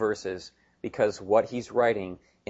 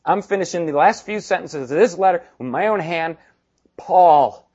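louder, emphatic declaration building to the signature; Paul taking the pen; 5 semitones higher, 8 dB louder than usual. Retold or imitated. Preacher voices Paul grabbing the pen from his secretary, emphatic. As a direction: declare it firmly, landing hard on the name. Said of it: "He grabs the pen and he goes".

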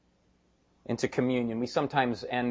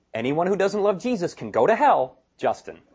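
mock email sign-off; 6 semitones higher, 4 dB louder than usual. Preacher imagines his own email handle, mock sign-off. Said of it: "when I'm writing an email".

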